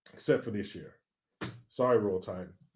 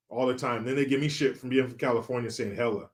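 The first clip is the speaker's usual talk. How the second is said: normal talking voice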